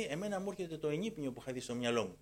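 usual speaking voice

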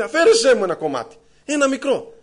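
high, insistent little plea; acted pleading. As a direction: beg for a small favour, high-pitched.

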